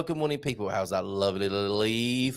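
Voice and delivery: normal talking voice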